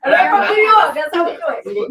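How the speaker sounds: loud, high New York brag; Queens girl accent. Retold or imitated. Playing a Queens bus girl, loud proud New York accent. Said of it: "that's how all the girls talk".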